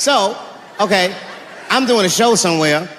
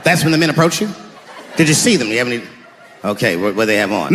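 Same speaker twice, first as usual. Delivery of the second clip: much lower, tired cop voice; weary police veteran; 10 semitones lower, 3 dB quieter than usual. Acted. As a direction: sigh through the report like a jaded officer. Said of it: "a police veteran taking this kid's police report".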